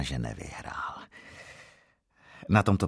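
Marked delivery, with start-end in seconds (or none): 0.0-1.0 whisper. Acted. Narrator's secret aside.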